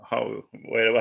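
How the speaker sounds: normal talking voice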